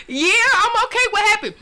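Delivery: high, laughing I'm okay; sheepish laughing. Retold or imitated. Answering her angry father from the hospital bed, high sheepish laugh. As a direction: play it innocent with a laugh.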